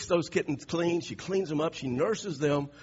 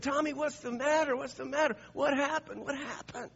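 a mother's high, anxious questions, pitched up; worried mom voice; 9 semitones higher than usual. Retold or imitated. Mom asking her crying son what's wrong, in a high anxious voice. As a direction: coax it out of the child like a fretting mom.

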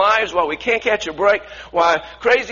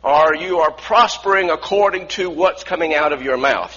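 normal talking voice

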